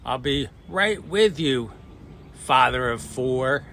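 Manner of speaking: mild retort shout. Retold or imitated. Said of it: "shouted back".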